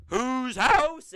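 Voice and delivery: high, loud entrance shout; catchphrase shout. Retold or imitated. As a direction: call the catchphrase to the arena. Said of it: "you hear".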